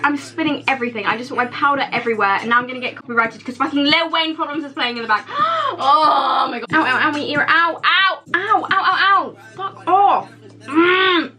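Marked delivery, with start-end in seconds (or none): 5.8-10.3 high, loud ow ow ow; yelping in pain. Real, not acted. Hurting her ear, high loud yelps. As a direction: cry out as the ear hurts.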